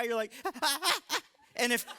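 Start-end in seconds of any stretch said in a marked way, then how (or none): 0.4-1.2 high squawking sob-laugh demo; chicken cry. Shown as a demonstration. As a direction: show the ugly chicken cry, high pitched. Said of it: "it's like a chicken cry".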